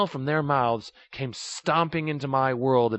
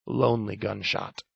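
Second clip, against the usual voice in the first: ordinary delivery, nothing marked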